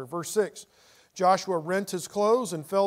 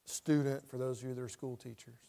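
normal talking voice

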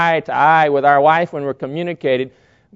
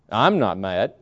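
low-pitched denial of anger; deep, contradicting tone; unconvincing denial; 8 semitones lower, 3 dB quieter, slower than usual. Shown as a demonstration. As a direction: say it low so the tone undoes the words. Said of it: "my tone of voice undid what I said".

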